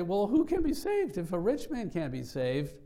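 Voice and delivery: high, loud incredulous question; astonished disciples. Retold or imitated. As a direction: voice the disciples' shocked disbelief. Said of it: "they were astonished at what Jesus said".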